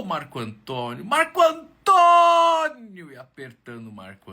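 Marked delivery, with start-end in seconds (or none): 1.0-3.1 very high, loud, stretched-out name; joyous greeting. Acted. Young man at bus stop hugging him, crying his name high and long. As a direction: squeeze him with your voice.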